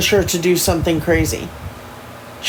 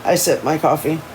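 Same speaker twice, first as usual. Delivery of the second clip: ordinary delivery, nothing marked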